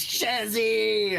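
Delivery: high, loud, drawn-out joyful shout; overjoyed cry of welcome; delighted greeting. Acted. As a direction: holler the name to a long-missed friend. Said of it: "gets up with a big smile and races out".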